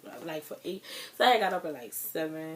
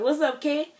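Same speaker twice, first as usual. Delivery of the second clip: higher and louder, a bright rising hello; cheery greeting; 9 semitones higher, 9 dB louder than usual. Retold or imitated. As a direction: call it out like a boss breezing in. Manager greets her at the sink.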